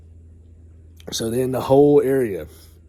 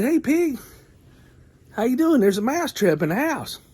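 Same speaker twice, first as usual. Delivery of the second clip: very high squeaky mouse voice; chirpy tiny mouse bringing bad news; little mouse; 12 semitones higher than usual. Mouse telling the pig, high squeaky voice.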